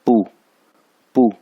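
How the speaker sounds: "पू" with a sharp falling jolt; "पू" as the Mandarin falling fourth tone. Shown as a demonstration. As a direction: punch "पू" downward, short and firm. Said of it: "four tone है".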